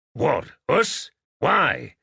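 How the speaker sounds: jumpy, rising questions; surprised. Acted. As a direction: caught off guard, a touch incredulous. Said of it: "Hamilton's voice registered surprise".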